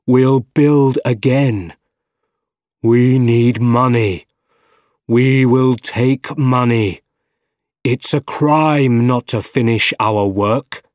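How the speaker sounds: slow, loud, drawn-out declarations; obsessed villain. A narrator's reading. Scientist controlled by the arms, obsessed and declaiming. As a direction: proclaim each line with grim resolve. Possessed scientist resolves to steal.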